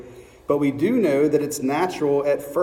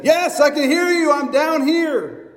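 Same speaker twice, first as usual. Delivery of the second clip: high, loud, slowed call upward; man hanging off a cliff answering the voice above; desperate Jack; 10 semitones higher, 4 dB louder than usual. Acted. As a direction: shout back up, clinging on.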